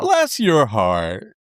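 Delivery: slow, sugary fake sympathy; drawn out like a sweet insult; sugary Southern put-down. To the cohost, mock Southern sweetness. Repeats the Southern phrase slowly.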